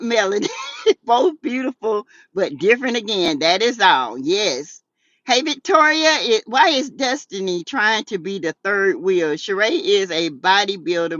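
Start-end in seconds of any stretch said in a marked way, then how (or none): none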